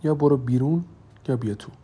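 mildly angry, firm order. A narrator's reading. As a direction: give an impatient ultimatum, with restraint. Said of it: "ریتا با عصبانیت به او گفت".

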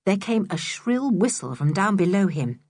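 ordinary delivery, nothing marked

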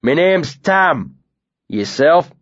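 a big bearded man feigning friendliness; lightly voiced northern bully; Tam, northern brute.

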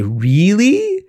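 REALLY swooping high into a question; long, rising, incredulous-style REALLY. Acted. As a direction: draw out REALLY, climbing steeply. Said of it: "they say".

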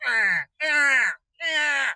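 put-on whiny, pouty grunts like a sulking man-child; mock a sulky leader's huffs; hammed-up pouting grunts; pouting Trump impression. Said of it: "That's my impression".

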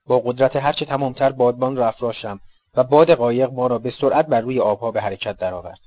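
plain reading voice

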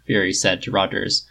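usual speaking voice

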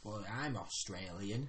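slow, low attempt at Australian accent; soft, drawn-out Australian vowels. Shown as a demonstration. Player demonstrating the accent for the game master, Australian. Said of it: "how to do that accent".

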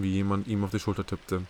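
ordinary delivery, nothing marked

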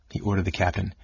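plain reading voice

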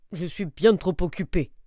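impatient bear voice; lightly voiced grumpy bear. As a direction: bear brushing off the neighbour. Bear brushes off rabbit.

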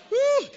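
a sudden high, loud whoop. Real, not acted. Preacher whoops to rouse the congregation, high and loud. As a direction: let out a joyful high woo.

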